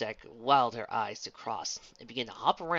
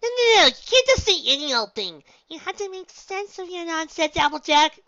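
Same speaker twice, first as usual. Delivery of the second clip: very high, loud objection; shocked gasping protest; 15 dB louder than usual. A narrator's reading. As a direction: squeal in outraged disbelief. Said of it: "Pinkie Pie gasped".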